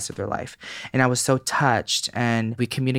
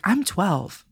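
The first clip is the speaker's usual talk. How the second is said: put-on kid's voice; 9 semitones higher than usual. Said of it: "He's like".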